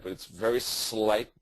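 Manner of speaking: slow down and hit "very" in "very slight"; stress on very. Shown as a demonstration. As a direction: lean hard on "very", drawn out and slowed. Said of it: "with emphasis on that word".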